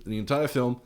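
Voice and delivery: normal talking voice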